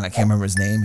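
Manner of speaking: ordinary delivery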